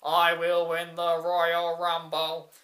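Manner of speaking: slow, drawn-out wrestler impression; mock slow declaration; Orton. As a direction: imitate a wrestler's ponderous vow, word by word. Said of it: "You have Orton who comes out who goes".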